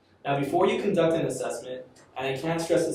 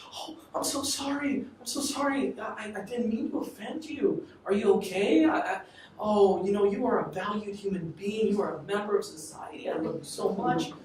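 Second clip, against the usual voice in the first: much higher, gushing apologetic mother; fawning mom; 7 semitones higher than usual. Retold or imitated. Mom apologizes to her son, high and gushing. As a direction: coo like a mother begging forgiveness. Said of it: "And what would his mom do?".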